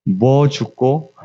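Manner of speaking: slow, drawn-out Busan question; low-key Busan dialect imitation, lingering. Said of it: "부산 사투리로 말씀해".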